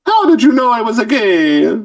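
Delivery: high, hammed-up game character voice; put-on high cartoon voice; mock Mario. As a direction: squeal it as an outed cartoon hero. Acting out Mario found out.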